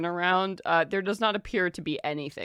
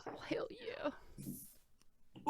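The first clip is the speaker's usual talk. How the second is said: breathy hushed hell yeah, much softer; excited whisper; 13 semitones higher, 10 dB quieter, much slower than usual. Real, not acted. Game master whispering delight at the player's roll.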